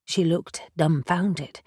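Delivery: plain reading voice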